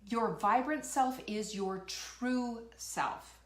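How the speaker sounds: slow, deliberate declaration; slow emphasis. Real, not acted. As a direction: lean on each word, slowly. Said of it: "I'm gonna tell you right now".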